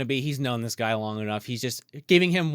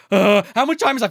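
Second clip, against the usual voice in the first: mock panic, higher and louder; anxious imitation, desperate for the time; acted jittery outburst; freaking out; 8 semitones higher, 6 dB louder than usual. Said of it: "freaking out".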